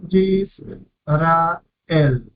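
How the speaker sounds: "Yis, ra, el" en tres golpes separados; separado en sílabas. Shown as a demonstration. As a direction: pausa entre cada sílaba de "Yis, ra, el". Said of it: "tres sílabas". ("Yis, ra, el" dividido para enseñar las sílabas)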